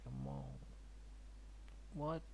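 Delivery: normal talking voice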